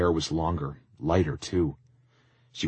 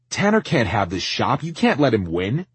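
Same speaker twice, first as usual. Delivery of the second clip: loud, raised-pitch protest; urgent protest; 9 semitones higher, 11 dB louder than usual. Acted. Tristan objects to Tanner getting the shop, loud and urgent. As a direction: plead forcefully, voice pushed up.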